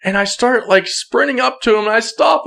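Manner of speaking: ordinary delivery, nothing marked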